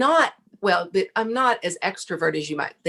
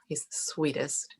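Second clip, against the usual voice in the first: a hushed, tender aside; fond whisper; 2 semitones lower, 9 dB quieter, slower than usual. Real, not acted. Telling her host about her sweet friend John, whispered fondly.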